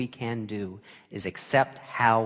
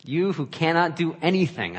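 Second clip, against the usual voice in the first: raised, scolding himself; self-scolding; 6 semitones higher, 5 dB louder than usual. Retold or imitated. Preacher reliving scolding himself, louder and higher. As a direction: bite it out in self-reproach. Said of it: "I said to myself".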